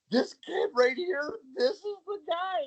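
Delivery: high, crying-edged squeal of excitement; breathless high-pitched gushing; excited squeal. Retold or imitated. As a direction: gush about him like she found a treasure. Friend excitedly introducing him.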